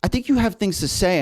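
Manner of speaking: light impression of a friend telling him to get on YouTube; encouraging friend. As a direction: pitch it up as the friend urging you on. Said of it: "They're like".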